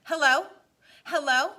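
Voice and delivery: loud, high, anxious call; calling into mic. A narrator's reading. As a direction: call out hoping someone answers. Brian calls into the radio.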